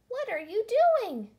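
high, loud little shout. A narrator's reading. Little old elf challenges Emily at the poles, shouting. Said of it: "shouted a little voice".

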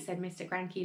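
ordinary delivery

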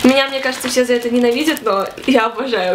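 normal talking voice

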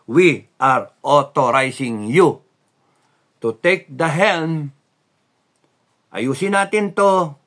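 rising forceful proclamation; emphatic command. Real, not acted. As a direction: proclaim it like an order. Commanding armed forces to take helm.

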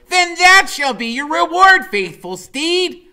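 very high, loud mock-archaic; rally the steed onward; Freak's knightly voice. A narrator's reading. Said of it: "Freak goes".

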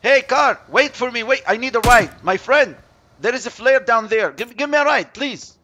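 high, loud hail to a driver; urgent, pleading yell; calling out. Pretend. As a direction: shout after a car for a lift. Calling after a passing car.

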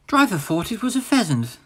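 soft, downcast engine voice; quietly telling a sad tale. Acted. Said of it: "Percy told Thomas sadly".

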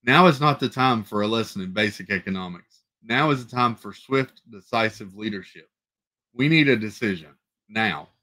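plain reading voice

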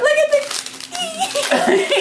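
high, slow words dissolving into laughter; laughing shout. Real, not acted. Showing friends a card, laughing high shout. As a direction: crack up mid-sentence.

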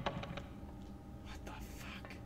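shocked whisper. Real, not acted. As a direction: whisper it in disbelief. Startled by something onscreen.

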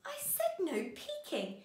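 gasp, then a high-pitched playful scolding; mock telling-off. Teacher catches her puppet peeking, mock scolding. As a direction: pretend shock at being disobeyed.

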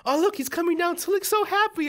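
high, cooing mom voice; imitated delighted mom. Mom watching son sled down, blissfully delighted. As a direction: pitch way up like a proud mother watching.

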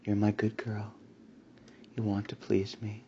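usual speaking voice

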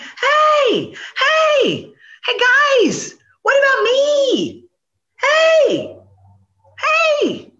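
high, loud calls across the water; desperate calling. Acted. Possum left on the bank calling to the swimming animals, high and loud. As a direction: yell after them, left behind.

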